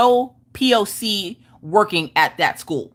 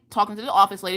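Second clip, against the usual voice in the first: normal talking voice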